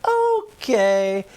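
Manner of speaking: high, drawn-out put-on "okay"; sing-song mock giving in; sitcom mom. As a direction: imitate an indulgent sitcom mother. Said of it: "And the mom's like".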